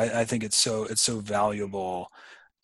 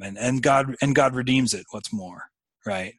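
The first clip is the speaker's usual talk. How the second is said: ordinary talk, nothing marked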